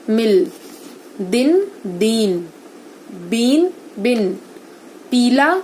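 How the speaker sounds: very slow word pairs, long vowel against short; "मील" with a long ii, "मिल" with a short i, each word drawn out; minimal pairs slowly. Shown as a demonstration. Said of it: "जरा जरा सा फर्क है इनके उच्चारण का". (दीर्घ और ह्रस्व स्वर का अंतर धीरे धीरे बोलकर)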